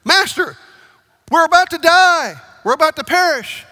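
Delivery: high, loud frantic cry; panicked shout. Acted. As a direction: yell in terror to wake him. Disciples repeat in panic.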